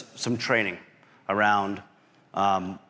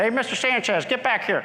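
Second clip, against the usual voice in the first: calling Pepper; 11 semitones higher than usual. Retold or imitated. Old instructor Pepper calling the officer back, high. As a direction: summon the young officer back. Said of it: "He said".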